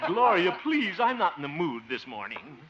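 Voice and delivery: loud, fed-up protest; exasperated plea. Acted. Harold fed up with Gloria's teasing. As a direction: beg her to stop.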